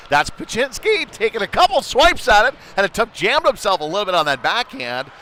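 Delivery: high, loud fast play-by-play; excited call. Real, not acted. Announcer calling a shot chance, high and loud. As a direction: bring the energy up on the chance. Scramble in front of net.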